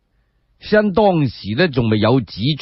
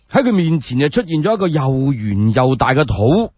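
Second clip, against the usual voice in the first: usual speaking voice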